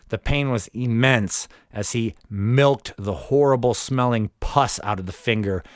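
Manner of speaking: ordinary talk, nothing marked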